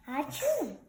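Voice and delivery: usual speaking voice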